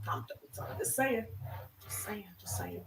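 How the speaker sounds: soft whispered aside; whispering. Real, not acted. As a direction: whisper it to yourself. Muttering to herself after crying.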